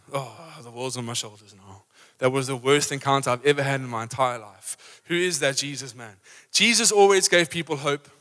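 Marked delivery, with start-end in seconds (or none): 0.1-6.2 low, soft, defeated moaning; weighed-down person. Acted. Preacher mimicking someone leaving Jesus, low and dejected. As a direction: mope as if the world sits on you. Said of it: "left like this".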